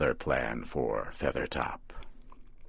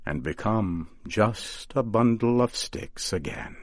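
plain reading voice

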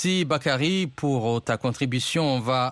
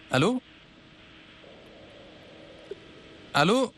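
high raised voice calling down the line; 8 semitones higher, 3 dB quieter, faster than usual. Real, not acted. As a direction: call out into silence. Host calling into a bad line.

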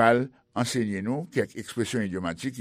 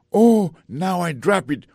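surprised dismay; 7 semitones higher, 7 dB louder than usual. Acted. Acted dropping the phone.

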